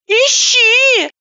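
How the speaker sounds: high, teary, slow phone voice; a weepy woman picking up the phone; tearful high woman. A narrator's reading. Vlasova advises.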